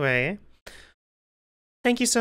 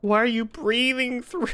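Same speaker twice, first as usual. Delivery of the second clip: normal talking voice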